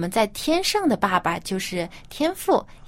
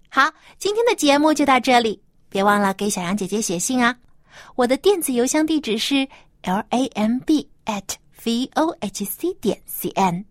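cheerful sign-off; 5 semitones higher than usual. Acted. Program sign-off to kids.

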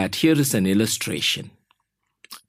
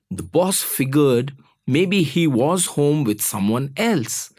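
usual speaking voice

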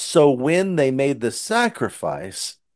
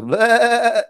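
high little bleat "Bleh"; lamb bleat; 11 semitones higher, 3 dB louder than usual. Retold or imitated. Lamb at the end of driveway, high bleat. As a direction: bleat like a lost lamb come home. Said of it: "he's at the end of the driveway and he goes".

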